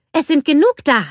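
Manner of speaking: high, loud, enthusiastic voice; eager exclamation. A narrator's reading. Anna opens the crate for Anders, eagerly exclaiming.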